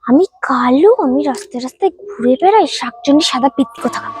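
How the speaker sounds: low, mocking parody of her; mocking mimicry. Mocking mimicry, muttered insult.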